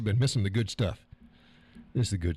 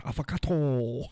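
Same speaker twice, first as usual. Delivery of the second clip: put-on higher-pitched play Japanese accent; mock Japanese accent; 5 semitones higher than usual. Rhyming avocado with arigato for fun, mock Japanese accent. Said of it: "Oh, that's Japanese".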